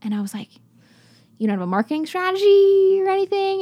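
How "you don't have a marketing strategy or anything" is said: high, loud incredulous question. Retold or imitated. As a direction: retell it in mock astonishment, pitched way up. Acted disbelief at vineyard owner's answer.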